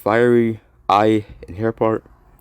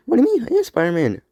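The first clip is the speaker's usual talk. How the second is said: higher, younger Spider-Man voice; Miles voice; 12 semitones higher, faster than usual. Acted. Miles cutting in to claim he is Spider-Man. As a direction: pipe up as the young hero. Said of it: "Not you, Miles".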